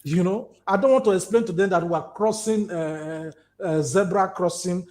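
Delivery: ordinary talk, nothing marked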